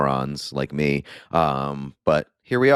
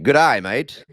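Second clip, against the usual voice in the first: louder, higher mock Australian accent; 7 semitones higher, 5 dB louder, slower than usual. Mock Australian accent as he jokes about baseball slang. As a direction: toss it off like a cheery Aussie fan. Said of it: "you can use good eye if you're Australian".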